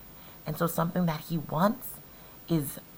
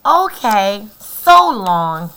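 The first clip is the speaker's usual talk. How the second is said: cheerful; 3 semitones higher, 15 dB louder, slower than usual. Acted. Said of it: "said Rosie cheerfully".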